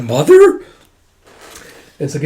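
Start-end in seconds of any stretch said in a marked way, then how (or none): 0.0-0.6 high, loud astonished rise; surprised question. Retold or imitated. Friends hearing she is his mother, surprised. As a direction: ask it in shock.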